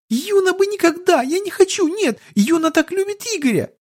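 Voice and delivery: put-on very high childish wail; tearful little girl. A narrator's reading. Sobbing child protesting her love for Igor, tearful. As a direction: plead in a tiny squeaky voice. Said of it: "разрыдалась горючими слезами".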